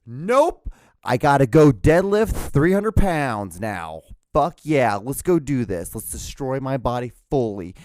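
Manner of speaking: loud, slow, amped gym bravado; pumped-up self hype. Retold or imitated. Telling himself to go deadlift, loud and pumped up. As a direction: psych yourself up to lift heavy.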